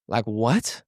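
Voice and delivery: gasp, then soft low rising question; shocked. Shown as a demonstration. Coach demonstrating the big pause, shocked. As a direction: take a shocked breath before asking. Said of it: "like, a big pause, like a shock".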